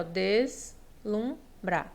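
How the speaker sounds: "deslumbrar" slowly split into des, lum, bra, pausing between; syllable by syllable. Shown as a demonstration. Teacher showing the correct syllable division, "deslumbrar" said syllable by syllable. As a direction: break "deslumbrar" into three separate, drawn-out syllables. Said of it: "A correta divisão silábica de deslumbra é a seguinte".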